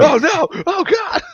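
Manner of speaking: high, loud, hammed-up horror; mock panic. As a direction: cry out in pretend dread. Acting the victim of the charging ghost.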